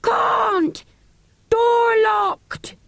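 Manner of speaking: high loud shout; loud deep shout. Acted. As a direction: yell down from locked tower. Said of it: "the same voice replied".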